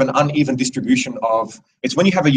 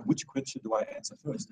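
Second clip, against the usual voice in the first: inner thought, whispered; 2 semitones higher, 15 dB quieter than usual. Retold or imitated. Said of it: "And I'm like".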